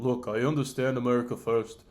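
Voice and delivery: a hint of a grave Netanyahu threat; lightly imitated Netanyahu, menacing; Netanyahu impression. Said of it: "Netanyahu angry at Americans".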